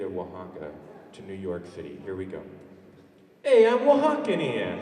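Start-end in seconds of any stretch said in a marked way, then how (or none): 3.4-4.8 high, loud, drawn-out self-introduction; hammed-up newcomer announcing himself; Oaxacan newcomer role. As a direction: call it out high, slow and loud, falling at the end. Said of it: "somebody who moved from the Mexican state".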